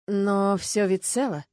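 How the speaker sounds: slow, drawn-out bewilderment; puzzled surprise. A narrator's reading. Police finding the coffin untouched, slowly puzzled. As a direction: say it slowly, taken aback. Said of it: "Полицейские удивлённо переглянулись".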